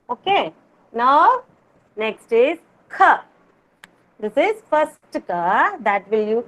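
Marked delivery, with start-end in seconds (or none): 5.1-5.2 K with no puff of air; unstressed K. Shown as a demonstration. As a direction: say K softly released, without an h sound. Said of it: "you will not stress and pronounce".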